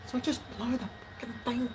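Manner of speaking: high, fast and exasperated; exasperated outburst. Real, not acted. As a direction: snap at the leaf blower. Annoyed at neighbour's leaf blower.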